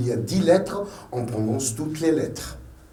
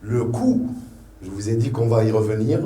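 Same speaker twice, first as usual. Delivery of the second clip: ordinary delivery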